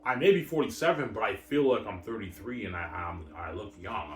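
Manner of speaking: normal talking voice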